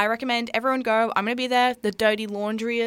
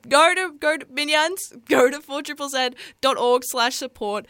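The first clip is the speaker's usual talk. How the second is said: lightly held Gru accent, raised pitch; Gru impression; 5 semitones higher, 4 dB louder than usual. Shown as a demonstration. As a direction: make the station plug as a so-so Gru. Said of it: "even though my Gru impression".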